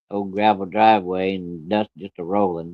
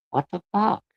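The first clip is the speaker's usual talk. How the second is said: shocked disbelief; 11 semitones higher, 4 dB quieter than usual. Retold or imitated. Shocked reaction to missing hogs.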